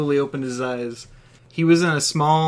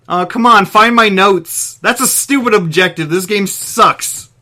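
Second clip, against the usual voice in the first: loud exasperated rant; 6 semitones higher, 8 dB louder than usual. Acted. Balak ranting at the game.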